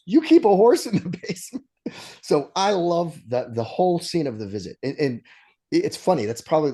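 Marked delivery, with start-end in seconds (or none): 0.1-1.7 laughing Rumsfeld quote. Retold or imitated. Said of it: "And Rumsfeld goes".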